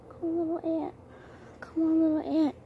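soft, high coaxing voice; gentle coaxing. Real, not acted. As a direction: coax the little ant gently. Coaxing an ant.

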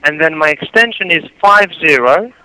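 normal talking voice

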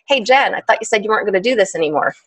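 ordinary talk, nothing marked

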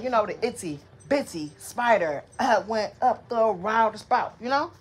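ordinary talk

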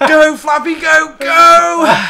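loud, slow, high cheering; excited cheer. Real, not acted. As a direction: cheer the goat on with full voice. Cheering the goat on.

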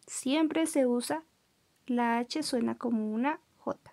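ordinary talk, nothing marked

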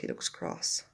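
ordinary delivery

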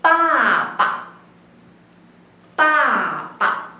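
pa and pa, said slowly and clearly; demo pa. Shown as a demonstration. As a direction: model each syllable for the class. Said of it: "copiar cómo yo pronunciarlo".

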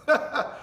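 quick amused chuckle; a brief laugh mid-sermon; short real laugh. Real, not acted. Preacher laughing at his own line to the church, chuckle.

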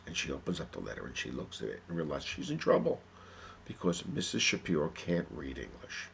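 ordinary delivery, nothing marked

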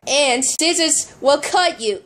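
usual speaking voice

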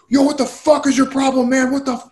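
worked up. Retold or imitated. Angry stranger confronts a man at his car, mock worked up. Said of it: "he's, like, all worked up".

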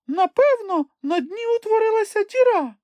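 tiny, very high squeaky voice; Chmykh guessing. A narrator's reading. Chmykh guesses about the shrunken lake, squeaky supposition. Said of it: "висловив припущення Чмих".

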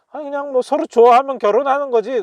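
high, loud, rapid acted protest; acted objection. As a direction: play an insistent character, pitched well up and rattled off. Acting out the translated dialogue.

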